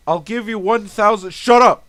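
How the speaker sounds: hammed-up angry shout. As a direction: cut someone off with a yell. Bribe, then shouted rebuke.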